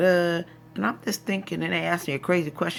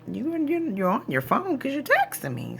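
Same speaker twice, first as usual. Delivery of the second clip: tag; 11 semitones higher than usual. Retold or imitated. Puzzled retold reaction to voicemail.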